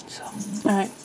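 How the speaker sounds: soft whispered wrap-up. Real, not acted. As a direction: whisper it under your breath.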